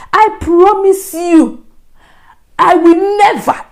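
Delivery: high, loud, slow promise; desperate vow. Retold or imitated. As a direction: stretch out each word of a solemn oath. Desperate vow to God in retold prayer.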